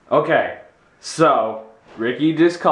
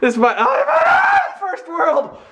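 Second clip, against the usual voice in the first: excited shout; 3 dB louder, slower than usual. Real, not acted. Excited first world reveal.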